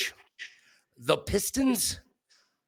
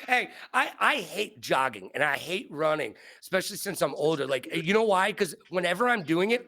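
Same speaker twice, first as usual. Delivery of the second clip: usual speaking voice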